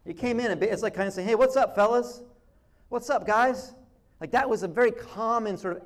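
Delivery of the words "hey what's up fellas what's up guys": upbeat, higher, louder hello to the guys; casual greeting. Acted. Someone making an entrance among friends, casual greeting. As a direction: breeze in and hail your buddies. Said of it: "Very almost casual".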